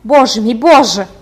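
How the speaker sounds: trembling loud "Боже мій, Боже!"; cry out. A narrator's reading. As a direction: gasp it out in sudden alarm. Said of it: "вигукнула, здригаючись".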